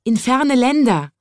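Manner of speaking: high chirpy birds; bird voices. A narrator's reading. Birds answering the chimney sweep, high and chirpy. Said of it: "antworteten die Vögel".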